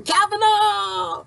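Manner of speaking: drawn-out high chant of a name; mock the crowd chanting Kavanaugh; crowd yelling. Imitating crowd yelling the judge's name, drawn out high. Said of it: "Everybody's yelling and screaming".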